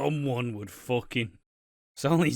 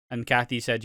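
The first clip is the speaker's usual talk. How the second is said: normal talking voice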